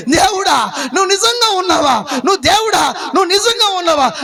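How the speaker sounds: the outcast woman alone, pleading with God while crying; tearful cry. Acted. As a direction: call out to God through tears. Lonely woman cries out to God.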